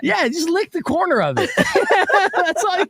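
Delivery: giggling through the words; laughing while talking. Real, not acted. Joking about tiny edible dose.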